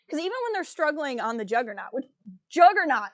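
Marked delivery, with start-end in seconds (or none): 2.5-3.1 sudden loud exclamation; mock outburst. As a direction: burst out with the name, much louder. Breaks off to exclaim the name.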